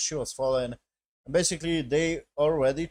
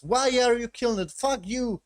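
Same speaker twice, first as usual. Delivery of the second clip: high, raised voice in angry protest; mock outrage; 11 semitones higher, 5 dB louder than usual. Captive protesting the killing of his partner, acted outrage.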